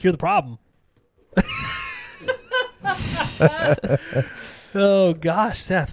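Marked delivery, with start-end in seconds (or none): 1.4-4.5 high burst of laughter. Real, not acted. Big laugh after wink joke.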